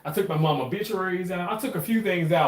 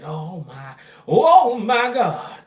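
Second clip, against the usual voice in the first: excited oh my God; 5 semitones higher, 6 dB louder, much slower than usual. Real, not acted. Tasting the jam, exclaims.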